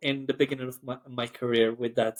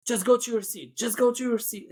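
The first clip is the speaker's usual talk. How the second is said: higher-pitched, insistent retold order; frustrated cabin crew; 8 semitones higher than usual. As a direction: send a passenger off, mildly frustrated. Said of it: "maybe she's frustrated".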